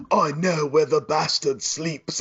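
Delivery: slow, lightly put-on English accent; a hint of stiff British diction, slowly; Keanu Reeves bad English accent. Friend imitating Keanu in Dracula, slow mock English accent. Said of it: "Keanu Reeves ... Bram Stoker's Dracula".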